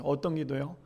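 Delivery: ordinary talk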